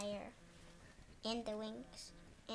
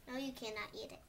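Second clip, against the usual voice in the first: usual speaking voice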